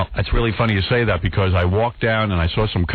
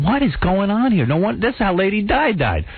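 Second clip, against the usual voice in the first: higher, alarmed disbelief; retold alarm; 8 semitones higher than usual. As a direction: sound rattled and confused. Retold panic, blinded by flash.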